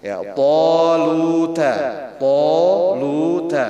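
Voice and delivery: toluta slow and full with a round, heavy to; slow Quran reading; slow recited toluta. Shown as a demonstration. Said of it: "tajwid reading demo".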